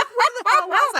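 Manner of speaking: high, flustered "where was I"; mock bewildered. Telling a friend she lost her story's thread, mock flustered. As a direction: throw up your hands, totally lost the thread.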